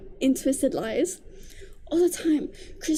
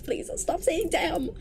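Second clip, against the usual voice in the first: usual speaking voice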